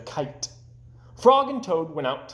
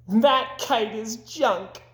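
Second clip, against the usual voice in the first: very high, slow mocking bird voice; jeering robins; 8 semitones higher, much slower than usual. Robins jeering at Toad's kite, very high and slow. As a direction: sneer it out high.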